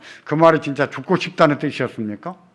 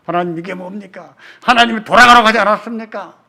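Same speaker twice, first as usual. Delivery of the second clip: louder, higher cry at God; anguished protest; 7 semitones higher, 8 dB louder than usual. Acted. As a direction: demand an answer in anguish. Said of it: "우리 뭐라고 합니까?".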